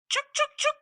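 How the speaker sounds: high chattering squirrel scold; sharp high squirrel chuks. Acted. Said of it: "said old Mama Squirrel".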